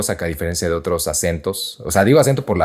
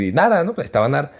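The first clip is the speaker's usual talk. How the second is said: imagined objector; 8 semitones higher, faster than usual. Retold or imitated. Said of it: "alguien puede decir".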